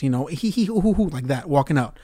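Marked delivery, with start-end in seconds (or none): 0.3-1.1 high mock giggle of an elderly couple; old people giggles. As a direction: giggle like a tittering old man, pitched up. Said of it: "doing old people giggles".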